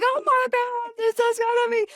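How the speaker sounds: high, loud thrilled squeal; excited squeal. Retold or imitated. Realising a night out with friend, squealing excitedly. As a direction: squeal with sudden delight. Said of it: "And I was like".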